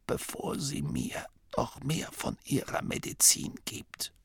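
dying abbot's whisper. A narrator's reading. The dying abbot whispering a secret into Masklin's ear. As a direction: breathe it out like a frail dying old man.

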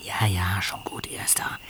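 hushed whisper. Acted. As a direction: whisper while hiding. Stakeout, hiding near house.

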